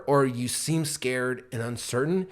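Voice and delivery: normal talking voice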